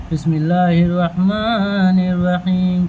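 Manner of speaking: a lightly drawn-out Bismillah; slow recitation. Acted. Teacher begins the drill with a slow Bismillah. Starts reading again.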